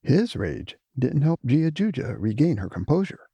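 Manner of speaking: plain reading voice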